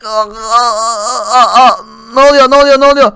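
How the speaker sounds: loud panicked gasping cries; can't breathe. Acted. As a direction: choke and gasp for air. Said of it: "Nefes alamıyorum".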